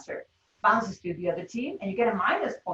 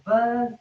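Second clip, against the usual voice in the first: a loud buzz like a game-show buzzer; buzzer sound; 6 dB louder than usual. Shown as a demonstration. Showing the class how to buzz in, loud buzz.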